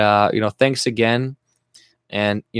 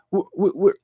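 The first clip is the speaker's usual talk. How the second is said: ordinary talk, nothing marked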